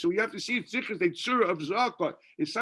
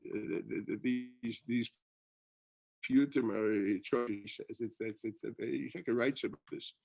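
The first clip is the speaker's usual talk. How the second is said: muttered aside; 7 semitones lower, 8 dB quieter than usual. Real, not acted. Muttered aside about piyutim.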